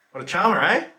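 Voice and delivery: loud, high mock admiration; sarcastic scorn. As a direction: toss it out as a sarcastic jab. Sarcastic remark on the attacker.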